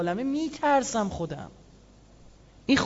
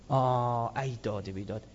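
mock lament; 9 semitones lower, 7 dB quieter, slower than usual. Lament voiced in the sermon.

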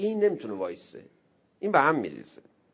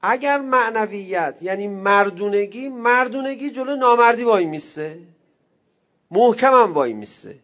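louder, higher preaching voice building up; forceful preaching; 4 semitones higher, 7 dB louder than usual. Acted. Preacher rousing the congregation, louder and higher. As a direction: drive the point home to the crowd.

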